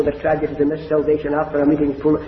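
usual speaking voice

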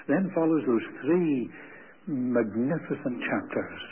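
ordinary delivery

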